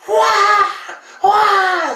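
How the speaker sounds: high loud imitated wail; mock screaming bloody murder. Imitating her screaming on a porch.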